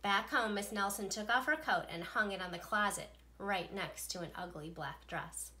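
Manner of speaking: plain reading voice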